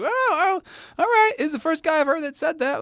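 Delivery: high, squeaky mock voice of himself; mock high reaction voice. Imitating his own startled reaction, high and squeaky. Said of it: "I was like".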